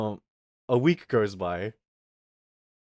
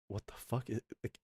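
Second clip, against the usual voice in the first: much softer, hushed disbelief; muttered aside; 12 dB quieter than usual. Retold or imitated. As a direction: mutter it under your breath, baffled. Inner reaction, she keeps standing.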